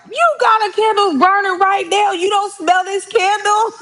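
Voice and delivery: very high incredulous sister voice; sister's voice. Retold or imitated. Sister telling mom a candle is burning, very high. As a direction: squeak it out in disbelief.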